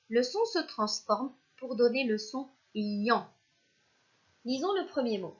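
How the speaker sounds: ordinary delivery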